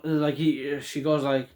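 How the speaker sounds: ordinary talk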